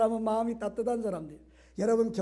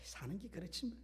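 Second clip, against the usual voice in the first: gloomy grumbling; 2 semitones lower, 6 dB quieter than usual. Retold or imitated. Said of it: "이러면 이게 이제 끝이잖아요".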